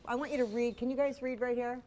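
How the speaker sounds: ordinary talk, nothing marked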